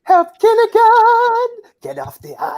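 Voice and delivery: high shrill cry for help; movie quote. Retold or imitated. Quoting a movie line.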